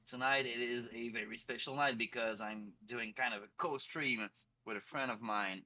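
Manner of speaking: ordinary delivery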